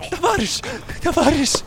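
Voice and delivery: high, breaking pleas; desperate. Acted. Doctor pleading with the dying Russian soldier, desperate. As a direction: plead as he slips away.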